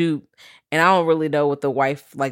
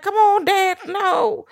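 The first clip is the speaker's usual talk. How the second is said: pleading son; much slower than usual. Retold or imitated. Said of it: "It's like".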